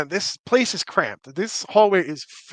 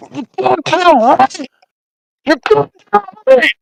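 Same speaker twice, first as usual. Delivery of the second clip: shrieked warning, much higher and louder; high piercing shout; 14 semitones higher, 13 dB louder than usual. Acted. Voice from Ryu's pocket, high shrieking shout. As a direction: scream it from inside his pocket. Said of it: "You just hear".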